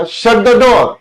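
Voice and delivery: loud high order; commanding order. Shown as a demonstration. Teacher demonstrating an order to students, loud and high. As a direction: snap it out as a command. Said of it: "मैंने कहा".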